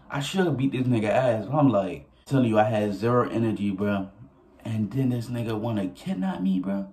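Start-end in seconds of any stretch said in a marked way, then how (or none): none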